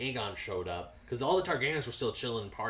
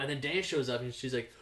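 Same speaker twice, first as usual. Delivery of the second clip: normal talking voice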